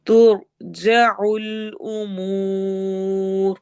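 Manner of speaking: extremely slow drawn-out chant; Quranic recitation. Shown as a demonstration. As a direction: stretch the syllables out long. Teacher recites Quranic verse.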